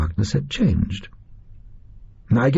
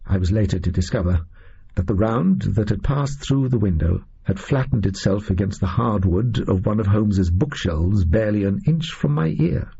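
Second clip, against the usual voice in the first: usual speaking voice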